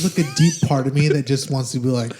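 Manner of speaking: ordinary talk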